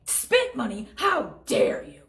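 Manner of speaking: high, loud, slow outrage; indignant. Acted. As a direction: gasp out the offence. Offended customer accused of spitting.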